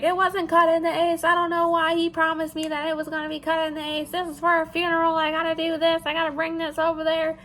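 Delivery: high, sustained rant imitation; mock screaming customer. Customer complaining about her funeral order, high mock rant. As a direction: imitate an irate customer in a high, unrelenting stream. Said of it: "So she's screaming".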